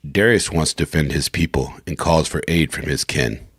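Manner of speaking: low, measured narration; announcer synopsis read. Acted. Retake of synopsis for recording.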